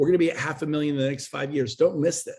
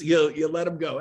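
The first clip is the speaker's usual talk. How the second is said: ordinary talk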